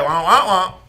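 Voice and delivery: muffled trombone-like wah wah syllables; Charlie Brown teacher wah-wah. Retold or imitated. Preacher imitating how he sounds to the congregation, muffled wah-wah. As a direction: mimic the unintelligible grown-up voice from Charlie Brown. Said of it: "Sometimes I feel like that's how I sound to y'all".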